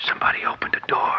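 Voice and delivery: urgent frightened whisper; nervous thief whispering a warning. Acted. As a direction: breathe it so no one hears. Burglars hiding from intruder.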